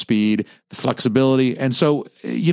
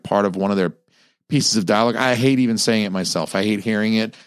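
normal talking voice

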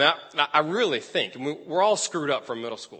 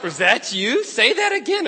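imitating an amazed girl, pitch leaping; hammed-up disbelief, rising high; 7 semitones higher, 4 dB louder than usual. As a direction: act out her high, astonished reaction. Retold girl amazed at new voice.

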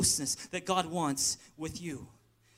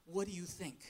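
quiet prayer; 10 dB quieter than usual. Retold or imitated. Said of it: "just asking God".

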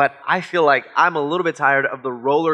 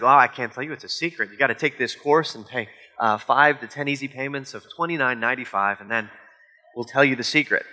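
lower, softer, teasing pitchman; mock sales pitch; 5 semitones lower, 4 dB quieter than usual. Seller teasing Americans with a secret course, mock pitch. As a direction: lightly imitate a cagey seller, voice dropped.

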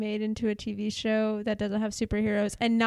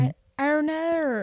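higher, muddled mock New Zealand accent; muddled accent attempt; 6 semitones higher, 3 dB louder than usual. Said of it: "What am I doing?".

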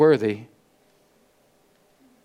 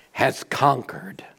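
slow whisper; 3 semitones lower, 4 dB quieter, slower than usual. Acted. Dramatic hush in the sermon.

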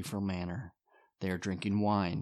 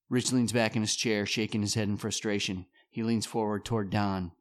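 normal talking voice

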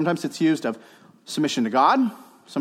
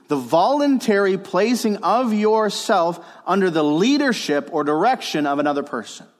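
emphatic definition; 5 semitones higher, 4 dB louder than usual. Real, not acted. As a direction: proclaim the key definition, easing toward the end. Gives a definition to note down.

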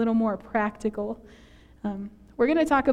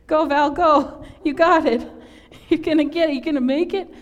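raised, louder encouragement; cheering someone on; 4 semitones higher, 5 dB louder than usual. Real, not acted. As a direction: call out encouragement to a friend.

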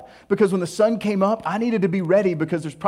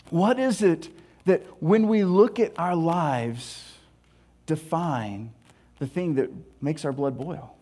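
normal talking voice

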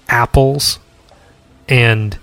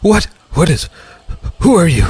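put-on startled old man; 6 semitones higher, faster than usual. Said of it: "he turns around and is like".

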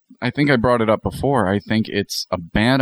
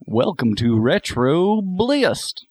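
slow, higher copy of a host's intro; imitating Johnny; 5 semitones higher, much slower than usual. Friend copying the host's line on request, Johnny impression. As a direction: draw out the greeting in mimicry. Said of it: "trying to do a Johnny impression".

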